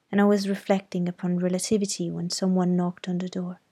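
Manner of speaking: ordinary delivery, nothing marked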